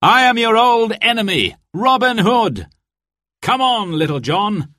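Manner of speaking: high, ringing hero's proclamation; triumphant. A narrator's reading. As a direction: reveal yourself boldly to your enemy. Robin triumphantly reveals himself, rallies friend.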